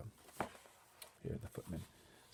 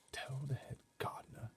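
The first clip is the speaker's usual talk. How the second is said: higher whispered order; 6 semitones higher, 3 dB quieter than usual. A narrator's reading. Butler ordering footmen, whispering. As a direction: hush it urgently. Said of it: "he whispered".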